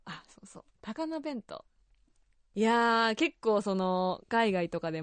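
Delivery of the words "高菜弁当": 「高菜弁当」 high and softer, Osaka melody; Osaka intonation. Shown as a demonstration. Host confirms the local bento pronunciation, Osaka intonation. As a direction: repeat 「高菜弁当」 softly the Osaka way. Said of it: "私が大阪で多分聞いてるのは".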